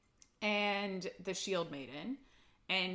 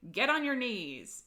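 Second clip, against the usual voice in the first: retold command; 6 semitones higher, 5 dB louder than usual. Acted order from the story.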